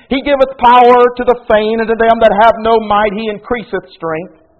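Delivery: high, loud ringing proclamation of scripture; preaching scripture. Acted. Preacher reading Isaiah to the congregation, loud proclamation. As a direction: lift the verse up and proclaim it.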